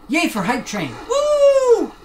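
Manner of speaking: big high whoop of joy; joyful woo; excited cheer. Real, not acted. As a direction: cheer for the hype train. Hype train celebration cheer.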